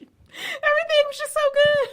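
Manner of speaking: high-pitched fake whine; mock lament. Cohost mocking betrayed wife's lament, mock wailing.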